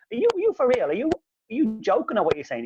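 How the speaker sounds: high-pitched disbelieving questions; incredulous. Retold or imitated. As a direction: challenge him, voice jumping up high. Acted disbelief at rival's insult.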